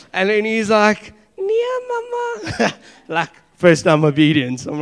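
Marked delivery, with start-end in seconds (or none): 1.4-2.7 put-on high, slow little-boy voice; child's reply. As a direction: mimic a small child answering mama. Child answers his mother in Afrikaans.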